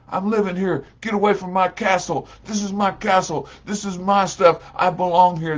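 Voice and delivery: high, loud possessive ranting; defiant brother. Acted. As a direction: stake your claim angrily. Brother defending his shack.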